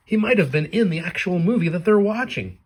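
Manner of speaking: normal talking voice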